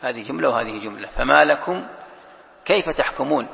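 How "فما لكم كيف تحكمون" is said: فما لكم left hanging, a break, then كيف تحكمون; pause after لكم. Shown as a demonstration. Teacher modelling the stop for reciters, pause after لكم. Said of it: "الأولى للقارئ أن يقف".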